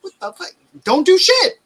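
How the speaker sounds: high-pitched, disbelieving exclamation; mock outburst. Dad reacting to son doing nothing all summer, mock high-pitched outburst.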